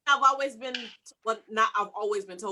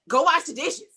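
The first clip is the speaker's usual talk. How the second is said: higher and louder, a barked order; harsh bossy command; 6 semitones higher, 4 dB louder than usual. Shown as a demonstration. Friend showing how her request got heard, harsh and bossy. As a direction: snap it like a demand. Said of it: "But it was received as".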